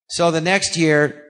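ordinary delivery

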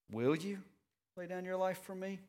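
sarcastic. Retold or imitated. Preacher voicing Jesus' reply to Peter, sarcastic. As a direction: let the doubt drip off the question. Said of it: "Do you hear the sarcasm in Jesus' voice?".

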